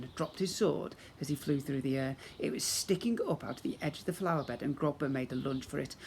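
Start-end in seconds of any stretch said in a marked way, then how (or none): none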